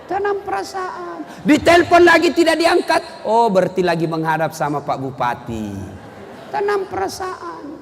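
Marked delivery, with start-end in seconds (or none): 0.1-1.4 high, soft, held-in sigh; swallowing feelings. Acted. Refrain, pressed down. 3.3-5.7 a wife reassuring herself about her husband; calm trusting wife. Acted. Istri berprasangka baik. 6.5-7.7 high, soft, held-in sigh; swallowing feelings. Acted. Refrain, pressed down.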